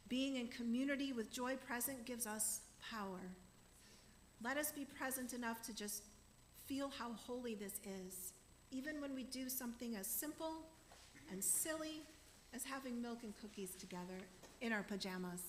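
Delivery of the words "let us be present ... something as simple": ordinary delivery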